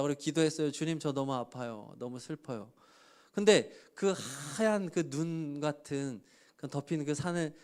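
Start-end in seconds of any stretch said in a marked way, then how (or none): 0.7-2.7 quiet tearful prayer. Retold or imitated.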